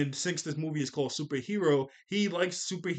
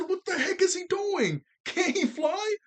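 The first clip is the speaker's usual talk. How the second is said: exasperated. Retold or imitated. Exasperated reaction to Krillin.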